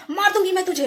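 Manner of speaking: high-pitched mock threat; imitated crude threat. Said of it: "ऐसे ही एकदम मतलब रेते वाला लैंग्वेज".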